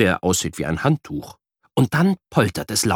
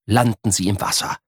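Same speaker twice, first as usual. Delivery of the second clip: usual speaking voice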